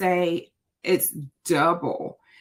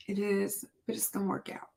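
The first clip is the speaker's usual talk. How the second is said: much softer, near breaking; soft reassurance; 10 dB quieter than usual. Real, not acted. Vlogger reassures herself through tears, much softer. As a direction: hush it out to steady yourself. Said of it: "I might be a little emotional".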